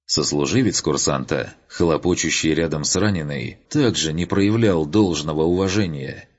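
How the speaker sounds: ordinary talk, nothing marked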